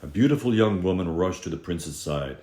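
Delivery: ordinary delivery, nothing marked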